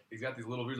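ordinary delivery

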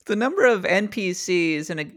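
ordinary talk

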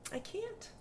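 tiny, high, very soft; high hushed protest; imitated exasperated mother. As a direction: mimic mom giving up in a small voice.

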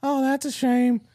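high, slow mock-sweet menace; high-pitched creepy elderly impression; veiled-threat old man. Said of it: "He goes".

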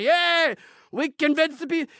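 high-pitched, gloating mock cheer. Mocking the manipulators celebrating, high gloating cheer. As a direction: squeal it like a smug villain celebrating. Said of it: "they get up and say".

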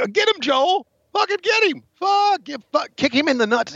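high, excited egging on; excited cheering. Real, not acted. Cheering on a brawl.

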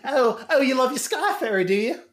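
high put-on British accent, teasing; mock British sneer. Joining the Gervais bit to mock the Sky Fairy, in a high British accent. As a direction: needle them like a smug Brit. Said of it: "that was a great accent".

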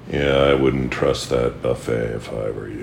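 sit back and say it slow and deep; Scott Fletcher impression. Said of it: "here's my impression of Scott Fletcher".

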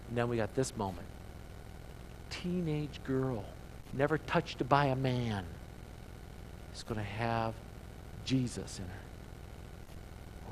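sinking to a hushed near-whisper, slowing down; a hushed, awed aside to the church. Acted. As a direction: drop the voice low and let it hang in awe. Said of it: "hushed build to the virgin birth".

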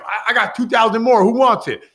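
high, loud mock-taunt to the whole plane; cocky bet challenge. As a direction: raise the stakes and dare everyone. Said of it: "And then he's like".

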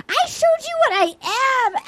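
high-pitched exasperated imitation; mock indignation. Recapping his argument with Katie, mock high indignation. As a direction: wail it indignantly, mimicking him. Acted quote of his outburst.

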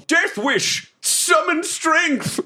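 high-pitched, drawn-out battle cry; heroic yell. Acted. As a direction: call out a spell like a theatrical hero. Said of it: "and I yell".

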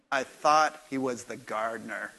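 sheepish confession, pitched low; embarrassed Mary. Retold or imitated. Mary admitting to John she mistook him, mildly embarrassed. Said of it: "She goes".

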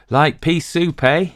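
grinning Dayton. Acted. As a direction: say it with a grin. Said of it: "he grinned".

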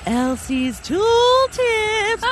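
high, slow, drawn-out title call; segment announcer. Acted. Guest announces the tips segment, sung-out title. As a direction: announce it like a jingle.

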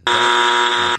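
loud, slow get out of here; loud and drawn out, amused; loud disbelief. Real, not acted. Reacting to silly guess.

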